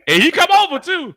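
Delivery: loud, high, through laughter; real laughing delight. Real, not acted. Co-host tells how the player came over, laughing hard. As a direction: crack up while telling the punchline.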